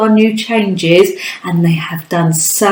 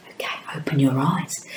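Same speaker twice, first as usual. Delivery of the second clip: whisper; 6 semitones lower, 13 dB quieter, faster than usual. Acted. Teacher whispering eyes-closed activity.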